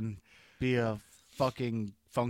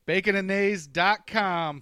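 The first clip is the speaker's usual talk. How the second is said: higher, louder, slow plug of "Bacon and Nays dot com"; 6 semitones higher, 5 dB louder than usual. Acted. Host loudly plugging the show's website. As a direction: shout the web address like an ad.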